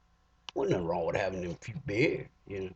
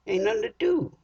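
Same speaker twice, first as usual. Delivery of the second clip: little uproar; 11 semitones higher than usual. Retold or imitated. Griping at the packed AA meeting, high and indignant. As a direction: squawk it up high like you're fed up. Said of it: "I had a little uproar".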